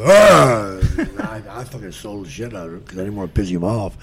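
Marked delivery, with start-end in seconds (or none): 0.0-0.8 a loud hammed-up shout, like a giant growling at his opponent; mock yell. Andre yelling in the ring, loud mock shout. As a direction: blurt out a big mock roar.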